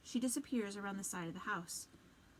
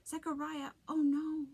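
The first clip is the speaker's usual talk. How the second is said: higher, louder alarmed cry; 7 semitones higher, 7 dB louder than usual. Acted. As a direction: gasp out in shock. Mother sees son on ground.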